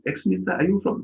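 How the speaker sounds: calling street vendor. Retold or imitated. Tyre seller stops a passer-by in summer, lightly mimicked sales call. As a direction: pitch it up like a hawker stopping a passer-by. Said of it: "they're even calling you".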